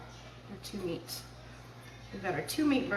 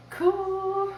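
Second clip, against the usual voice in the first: thrilled; 5 semitones higher, 3 dB louder than usual. Real, not acted. Thrilled at finished burgers.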